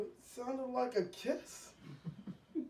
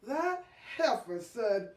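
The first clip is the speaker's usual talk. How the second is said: usual speaking voice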